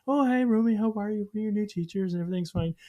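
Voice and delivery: put-on a teacher's bright welcome to a shy kid; sweet preschool teacher. Teachers greeting a new toddler.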